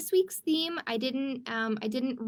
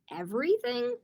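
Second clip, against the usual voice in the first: mild surprise; 9 semitones higher than usual. Acted. Mother asks in disbelief.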